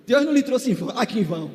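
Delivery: ordinary delivery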